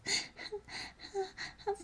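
soft high unvoiced whimper-laugh; half laugh, half whimper; breathless whimpering laugh. Aroused, overwhelmed moment.

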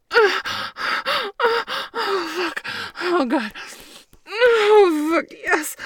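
high, loud climactic moaning; loud high-pitched moans. Acted. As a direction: cry out in rising pleasure.

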